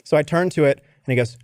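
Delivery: ordinary delivery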